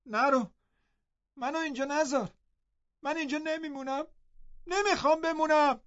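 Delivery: wailing plea not to be left; crying and screaming. A narrator's reading.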